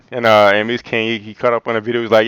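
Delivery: ordinary talk, nothing marked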